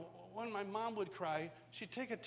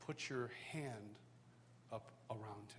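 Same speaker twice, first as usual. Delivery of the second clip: quiet voice of God; 8 semitones lower, 8 dB quieter, slower than usual. Retold or imitated. God's quiet instruction voiced.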